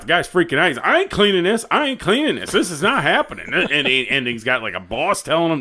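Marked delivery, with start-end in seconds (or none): 0.8-3.5 refusing worker. Retold or imitated. Young worker refuses to clean.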